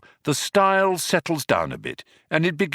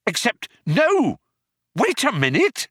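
high, urgent exclamation; sudden hope; 12 semitones higher, 3 dB louder than usual. Acted. Companion suddenly sees a way out of the airlock, urgent. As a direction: break off with a high burst of hope. Ford suddenly spots possible escape.

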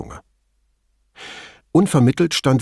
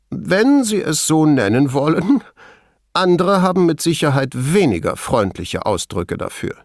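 higher-voiced, animated lab chief; Veltrini, amused; 6 semitones higher than usual. A narrator's reading. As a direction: speak up, wryly amused. (amüsierter Laborchef, höhere Stimme)

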